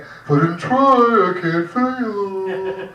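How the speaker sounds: very slow, drawn-out mock motto; Portland type. Mocking the Portland attitude to failure, drawn-out. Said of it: "It's a real Portland thing".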